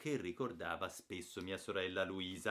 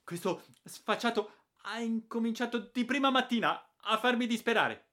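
much higher, louder, trembling with rage; shrill furious aunt; 9 dB louder than usual. A narrator's reading. Aunt accusing the boy to his father, shrill fury. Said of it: "con la sua voce stridula e tremando dalla rabbia".

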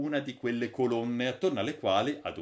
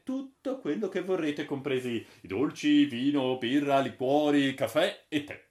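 louder, enthusiastic host listing dishes; lightly voiced Holker; 5 semitones higher, 5 dB louder than usual. Holker presenting the automatic menu to his friends, louder. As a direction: sell the wonders of the menu.

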